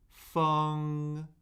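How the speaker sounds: "Feng" held high and flat; first tone. Shown as a demonstration. Teacher cycling four tones on a syllable, "Feng" high and flat. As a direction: say "Feng" on a high, level pitch. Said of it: "Let's do the four tones".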